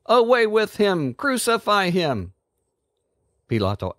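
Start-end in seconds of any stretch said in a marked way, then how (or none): 0.0-2.3 high, urgent crowd cry; yelled. A narrator's reading. Crowd demanding crucifixion, yelling high. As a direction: call for the crucifixion, pitched way up. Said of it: "they yelled".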